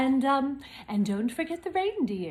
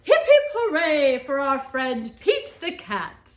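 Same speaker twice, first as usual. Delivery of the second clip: cheer; 2 semitones higher, 6 dB louder than usual. Acted. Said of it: "and cried".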